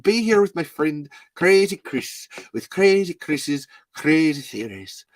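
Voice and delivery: put-on Irish accent; Cillian Murphy. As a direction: play it as an Irishman in a monster movie. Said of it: "you're playing Cillian Murphy in a Tremors movie".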